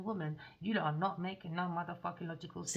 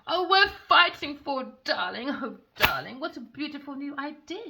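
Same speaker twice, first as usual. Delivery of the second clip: mocking her; 6 semitones higher, 5 dB louder than usual. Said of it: "she said".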